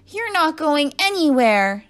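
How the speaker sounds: high, loud, drawn-out challenge; defiant shout. A narrator's reading. Pupil turned apple to teacher, defiant shout. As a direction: shout it slowly to stop someone. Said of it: "shouted Todd".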